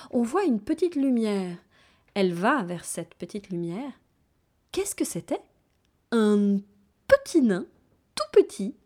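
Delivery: ordinary delivery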